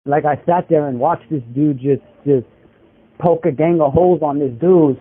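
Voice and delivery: normal talking voice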